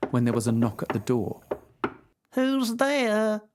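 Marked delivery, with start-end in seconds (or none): 2.3-3.4 put-on higher, quavery old lady call; old grandmother. A narrator's reading. Grandmother calling to the door, old and frail.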